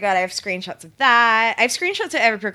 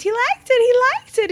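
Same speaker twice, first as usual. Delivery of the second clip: high retold squeal; excited squeal. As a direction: squeal it like a fangirl. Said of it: "I, like, screamed".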